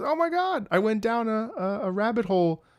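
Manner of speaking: high-pitched starstruck voice; gushing fan. Retold or imitated. Fan meets the streamer at a store, high excited gush.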